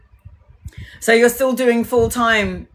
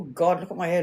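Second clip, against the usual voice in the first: normal talking voice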